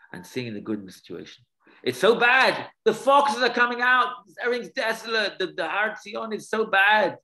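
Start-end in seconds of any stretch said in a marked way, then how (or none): 1.8-7.2 mock lament. Rabbis voiced lamenting the ruins.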